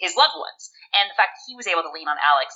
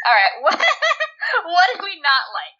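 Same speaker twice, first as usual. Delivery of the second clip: high, laughing question; 10 semitones higher, slower than usual. Real, not acted. Host moving on to dislikes, laughing. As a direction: ask it through real laughter, slowly.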